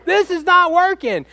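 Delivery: high, loud, slow mock shout at himself; mock yelling. Preacher acting out yelling at his two-year-old, hammed-up shout. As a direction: yell it like a dad losing the battle. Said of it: "I'm going".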